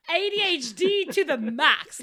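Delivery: loud and slow, every letter spelled out; excited, emphatic. Real, not acted. Laughing at her own impatience, loud and slow. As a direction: hammer each letter out gleefully.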